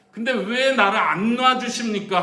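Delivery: high, loud, slow protest; acted cry of grievance; protesting complaint. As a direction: demand an answer from God. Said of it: "불평을 합니다".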